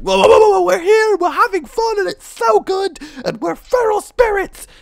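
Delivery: very high, loud cartoon squeal; excited chipmunk chorus; squeaky feral spirits. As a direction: play giddy little spirit creatures. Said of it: "They're all like".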